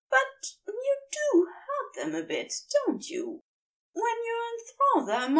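high, earnest angel voice; Aziraphale's voice. A narrator's reading. The angel tells the demon he is different, high and earnest. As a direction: lift the voice high and keep it gentle.

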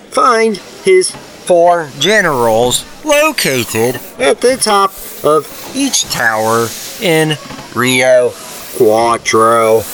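slow, even, machine-like quest announcer; game computer voice. Acted. Game narrator voice telling the player the next objective, slow and mechanical. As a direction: read the objective like a game's computer.

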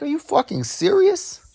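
high incredulous are you serious; retelling how he reacted to the coworker, incredulous; disbelief.

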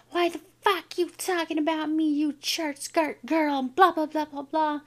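high-pitched mock confrontation; retold bully confrontation. School bully confronts her, acted.

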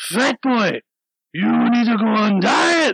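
high, slow, loud taunt; imitated drunk heckling. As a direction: drag out each mocking shout. Repeats the drunk men's heckle.

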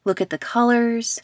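measured meditation guide with long pauses; guided meditation. Acted. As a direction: lead listeners into relaxation, leaving space after each phrase.

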